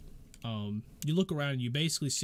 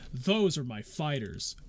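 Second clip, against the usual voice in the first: slow proud; 3 dB louder, slower than usual. Acted. Joseph presents the party.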